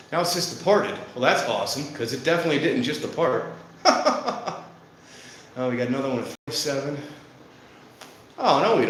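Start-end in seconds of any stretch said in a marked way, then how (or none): 3.8-4.7 high burst of real laughter. Real, not acted. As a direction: laugh out loud at the absurd update. Laughs at wrong departure board.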